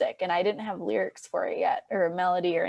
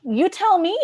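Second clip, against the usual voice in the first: sassy retort; 9 semitones higher, 7 dB louder than usual. Retold or imitated. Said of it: "And I'm like".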